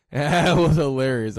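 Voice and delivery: loud, high laughing reaction; genuinely cracking up through the words. Real, not acted. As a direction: burst out loud, really amused. Cracking up at clip.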